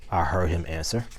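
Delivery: plain reading voice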